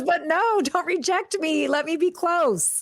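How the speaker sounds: put-on crying little boy. Inner little boy begging his wife for closeness, high pleading voice. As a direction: play the hurt inner child begging not to be pushed away. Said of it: "he starts crying".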